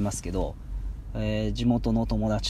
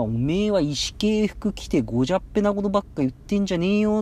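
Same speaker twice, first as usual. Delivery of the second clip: higher Ibaraki dialect telling-off; Ibaraki dialect scolding; 7 semitones higher than usual. Retold or imitated. A local scolding a sloppy, badly dressed person, in Ibaraki dialect. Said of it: "って言われたら".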